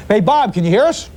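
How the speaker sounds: normal talking voice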